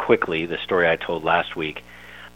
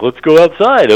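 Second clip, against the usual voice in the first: much higher, louder dopey dog voice; 11 semitones higher, 10 dB louder than usual. Retold or imitated. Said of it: "I'm imitating my dog".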